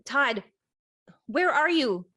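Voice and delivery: calling from another room, high and loud. Acted. Cassie calling for Todd through the house, loud and high. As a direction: call out for him, worried.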